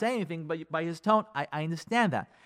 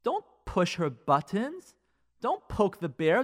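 normal talking voice

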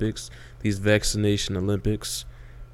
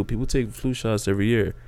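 ordinary talk, nothing marked

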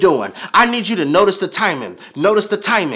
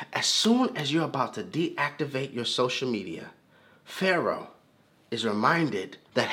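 much softer, lower, drawn in close; hushed preaching; 6 semitones lower, 11 dB quieter than usual. Acted. Preacher hushing the church before Pharaoh's line, quiet. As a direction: pull the congregation in with a hush.

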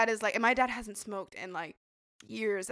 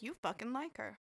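much softer, under the voice; quiet aside; 12 dB quieter than usual. Retold or imitated. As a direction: say it privately, for one person's ears. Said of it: "like, quietly to me".

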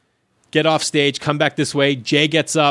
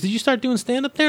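high-pitched retold question; Courtney Cronin; 10 semitones higher than usual. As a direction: imitate a woman quizzing a newcomer, pitched up. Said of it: "And she goes".

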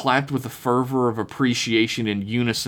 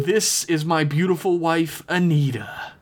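higher-pitched, showy presentation; cult-leader introduction; 7 semitones higher than usual. A narrator's reading. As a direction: present her grandly to the captives. Cult leader presents his wife.

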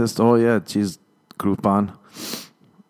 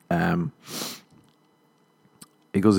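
usual speaking voice

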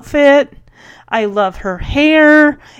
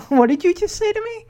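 mock-incredulous, higher and quick; taken aback; 9 semitones higher, faster than usual. Stunned Billy to Dottie, in mock disbelief. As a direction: play stunned, through a laugh.

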